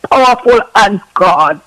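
steady rhythmic prayer, every phrase closing on a falling, sinking God; fervent prayer. Acted. Intercessory prayer over Miss Dixon.

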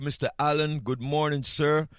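ordinary delivery

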